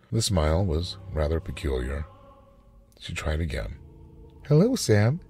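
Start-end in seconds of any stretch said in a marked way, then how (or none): 4.5-5.2 high, bright rehearsed hello; practising a bright casual voice; bright forced greeting. A narrator's reading. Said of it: "she said, brightly".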